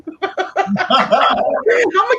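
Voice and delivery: a sudden high cackle at her own joke; high burst of laughter. Real, not acted. Laughing at her own warning to the client.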